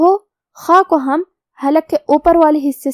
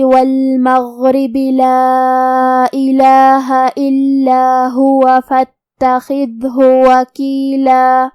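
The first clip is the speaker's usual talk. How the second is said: slow melodic tajweed chant; Quran recitation; slower than usual. Shown as a demonstration. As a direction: recite the verses slowly and melodically. Recites the verses. (قرآن کی ترتیل)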